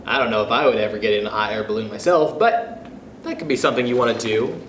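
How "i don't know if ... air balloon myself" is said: ordinary delivery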